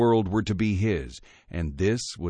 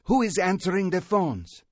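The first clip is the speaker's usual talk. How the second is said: angry demand; 10 semitones higher than usual. A narrator's reading. Angry demand over intercom.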